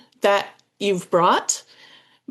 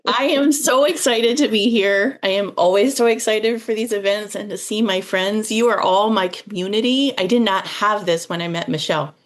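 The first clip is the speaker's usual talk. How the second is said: usual speaking voice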